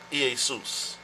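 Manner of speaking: "Jesus" said ee-ay-SOOS; original-form pronunciation. Shown as a demonstration. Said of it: "it is a transliteration from the word".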